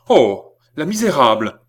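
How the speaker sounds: acted burst of indignant horror; horrified outcry. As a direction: cry out at a sudden betrayal. Horrified discovery of a betrayal.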